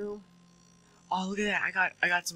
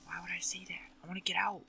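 whisper; 4 semitones higher, 11 dB quieter than usual. Real, not acted.

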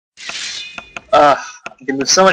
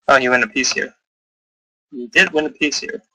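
ordinary talk, nothing marked

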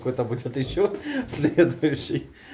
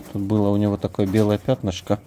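ordinary talk

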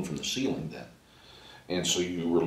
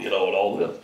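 ordinary talk

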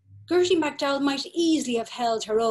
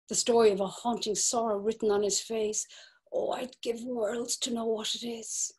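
ordinary talk, nothing marked

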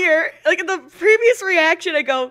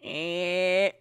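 one long, low, soft "eh"; drawn-out quiet grunt; imitated nudging grunt; 11 semitones lower, 8 dB quieter than usual. Streamer showing how they nudge fiance, soft low grunt. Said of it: "I'll go".

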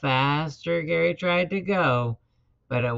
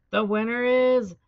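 ringing race-announcer proclamation; winner announcement; 7 semitones higher, 3 dB louder than usual. A narrator's reading. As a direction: announce the champion to the crowd, stretching the name.

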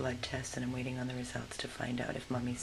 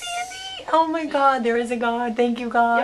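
loud, much higher squeals of relief; overjoyed shout; 11 semitones higher, 17 dB louder than usual. Real, not acted. Rescuer hearing the test is negative, shouting with joy. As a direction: burst out in joyful relief.

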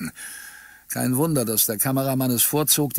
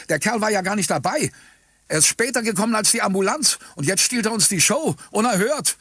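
high, rapid outraged cries; indignant protest; 8 semitones higher, 3 dB louder than usual. A narrator's reading. Crowd protesting that he steals the show, indignant. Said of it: "Ringsum ertönten Protestrufe".

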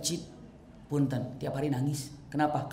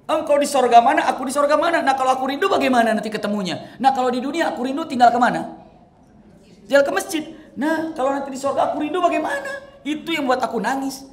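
high, loud, tearful reasoning; anguished pleading; 8 semitones higher, 7 dB louder than usual. Acted. Thauban telling the Prophet why he weeps, high and loud. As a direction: plead your worry through tears. Thauban explains his tears.

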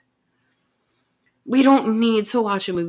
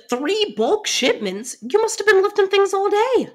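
surprised sympathy; 11 semitones higher, 4 dB louder than usual. Acted. Girlfriend hears how hard her boyfriend worked, surprised and much higher. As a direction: react as if he just told you something unbelievable.

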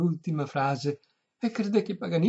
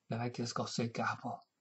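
ordinary delivery

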